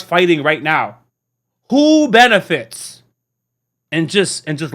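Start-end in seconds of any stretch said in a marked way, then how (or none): 1.7-3.0 Who benefits drawn out, pointed; slow and pointed, let it land; emphatic slow question. Real, not acted. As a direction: rhetorical jab, slowed right down. Rhetorical question slowed.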